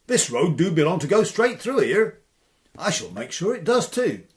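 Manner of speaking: loud, indignant country lad; loud rustic protest; Tom's bold voice. Acted. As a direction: declare it like a stubborn Cornish farmhand. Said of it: "said Tom".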